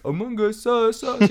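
a hint of a theater kid chanting a meme; mock theater kid. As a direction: lightly imitate a cringey theater kid. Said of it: "going like".

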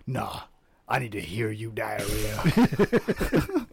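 low, slow bossy drawl; boss voice. Retold or imitated. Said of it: "had a boss that's been like".

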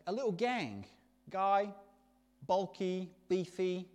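ordinary delivery

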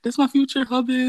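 mock fan girlfriend cheering her streamer boyfriend. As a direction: cheer him on like his biggest fan. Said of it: "I'm like".

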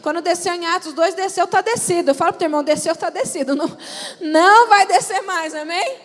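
loud, driving preacher's appeal; rising revival-style exhortation; fervent preaching. Acted. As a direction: preach it out with full force. Preaching on Spirit descended.

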